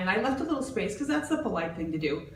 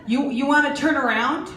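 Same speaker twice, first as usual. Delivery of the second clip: loud, high, sarcastic challenge; confrontational callout; 5 semitones higher, 5 dB louder, slower than usual. Retold or imitated. Jenny loudly confronting the woman who cut, sarcastic. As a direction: call her out in front of everyone. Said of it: "Forty-seven-year-old Jenny said".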